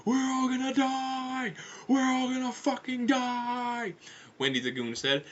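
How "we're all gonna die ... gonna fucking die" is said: high, slow, long-held scream; doomed wailing. Acted. Wendy as the ship nosedives, high panicked wail. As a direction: wail in panic, stretching every word.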